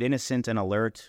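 plain reading voice

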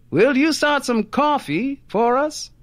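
high, carrying request; calling out. A narrator's reading. Husband asking his wife for coffee, calling out. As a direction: pitch it up to reach the next room. Husband calls to next room.

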